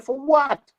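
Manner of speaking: loud, very high incredulous question; outraged disbelief. Retold or imitated. Accused man answers being told about court, outraged disbelief.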